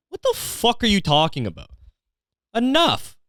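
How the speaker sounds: very high-pitched, incredulous outburst; hammed-up fed-up customer; exasperated rant. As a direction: cut them off in comic outrage. Comic rant at pizza business.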